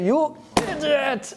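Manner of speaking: high, slow, drawn-out "You idiot!"; mock insult. Devotee venting at a friend in a mock quarrel, hammed-up. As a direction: hurl it at a friend in a play fight.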